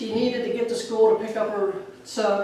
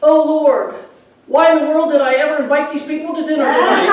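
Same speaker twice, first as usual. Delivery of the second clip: mock prayer; 6 semitones higher, 6 dB louder than usual. Girl repeats mother's grumble as prayer.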